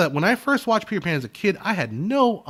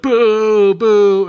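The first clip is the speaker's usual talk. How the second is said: loud high mock boos; crowd booing; 8 semitones higher, 11 dB louder than usual. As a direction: bellow boos like a whole panto audience. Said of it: "everyone's like".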